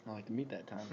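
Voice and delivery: playful low hush to co-host; murmured. Acted. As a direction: hush it back jokingly. Mock low murmur reply.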